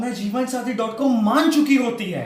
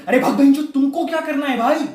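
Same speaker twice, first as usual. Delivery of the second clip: loud, higher angry retort; irritated snap; 5 semitones higher, 6 dB louder than usual. Acted. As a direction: shout the nosy questioner away. Snaps at the nosy watchman's question. (चिढ़कर ज़ोर से झिड़कना)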